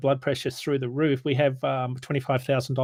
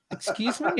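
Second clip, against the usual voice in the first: incredulous excuse me; 7 semitones higher, 5 dB quieter than usual. Retold or imitated. Incredulous retort to rude rep.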